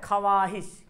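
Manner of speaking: ख्वाहिश as "kha-waa-hish", w sounded; spelling pronunciation. Shown as a demonstration. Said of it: "लिखा हुआ क्या है?". (ख्वाहिश को "खवाहिश" पढ़ें)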